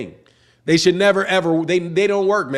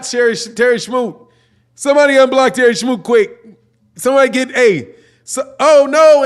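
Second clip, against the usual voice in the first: frantic call; 6 semitones higher, 5 dB louder than usual. Real, not acted. Frantic fixing accidental block.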